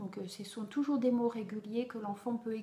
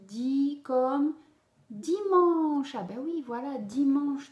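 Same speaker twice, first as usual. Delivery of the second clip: cheerful praise; 4 semitones higher, 6 dB louder, slower than usual. Acted. Teacher cheers the child's word.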